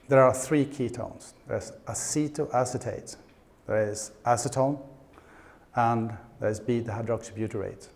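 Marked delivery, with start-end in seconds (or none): none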